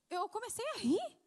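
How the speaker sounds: ordinary delivery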